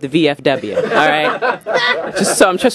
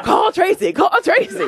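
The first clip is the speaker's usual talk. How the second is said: alarm shout; 12 semitones higher than usual. Retold or imitated. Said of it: "there was a sensor ... it went off".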